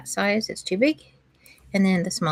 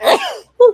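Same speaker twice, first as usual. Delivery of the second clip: loud real sneeze with an ooh; sneezing; 12 dB louder than usual. Real, not acted. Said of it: "Excuse me, I have to sneeze".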